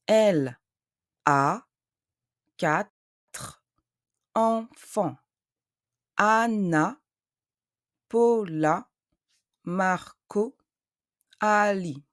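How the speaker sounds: slow French, syllable by syllable with long gaps; syllabe par syllabe. Shown as a demonstration. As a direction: sound out each French syllable separately, deliberately. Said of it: "emphasize the syllables".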